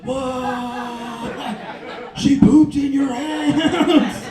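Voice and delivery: drawn-out, high-pitched disbelief; hammed-up horrified outcry; mock shock. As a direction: play an appalled crowd, stretching the words. Acts the shocked audience.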